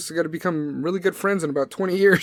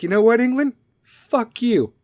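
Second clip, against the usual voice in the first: higher-pitched mock comeback; hammed-up "so there" retort, pitched up; mock defiance; 7 semitones higher, 4 dB louder than usual. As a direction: throw the insult back, playfully indignant. Acted Scotland answering England back.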